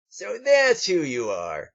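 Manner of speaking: high, slow gloating; sneering Fritz. Acted. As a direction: savor the discovery with a sneer.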